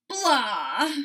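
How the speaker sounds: loud grumbling blah; sleepy groan. Acted. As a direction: groan from under covers. Toad groans from inside.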